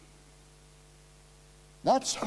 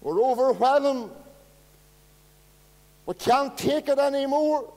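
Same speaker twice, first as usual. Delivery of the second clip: high and loud, pressing each line home; preaching at full force; 11 semitones higher, 8 dB louder than usual. Acted. Preacher calling out the desperate brother and sister, full-force preaching. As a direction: lift the hall, hammer every phrase.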